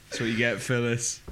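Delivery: ordinary talk, nothing marked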